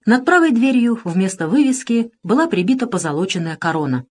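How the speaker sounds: usual speaking voice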